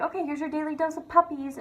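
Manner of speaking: high-pitched sweet teacher announcement; imitated cheery teacher. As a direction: present a little treat to the class.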